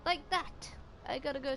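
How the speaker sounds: high excited outburst; sudden loud delight. Real, not acted. Excited at the game.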